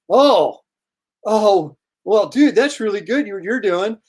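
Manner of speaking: lightly voiced drunk admirer catching on; drunk fan. Drunk fan realizing he mistook the artist, lightly imitated. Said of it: "and the guy's drunk".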